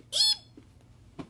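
tiny high eep; high squeak. Acted. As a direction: squeak like a startled chick. Chicken squeak.

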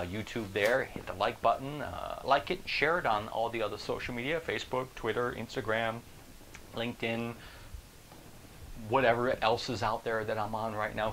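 usual speaking voice

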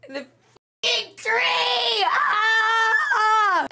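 high, long-held wailing scream; anguished wail. Real, not acted. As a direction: wail it out slowly into a cry. Wailing at the scene.

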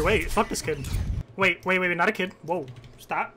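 ordinary talk

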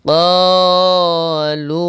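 ক্বালু drawn out, high and sustained; long madd. Shown as a demonstration. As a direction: stretch the vowels of ক্বালু in recitation. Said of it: "তিন আলিফ টান হবে".

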